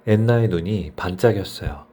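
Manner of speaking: ordinary delivery, nothing marked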